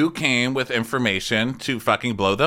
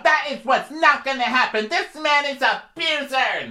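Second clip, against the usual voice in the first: very high shrill mock screaming; mock screaming fit; 3 dB louder than usual. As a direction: throw a tantrum in shrieks. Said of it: "screaming and having a fit".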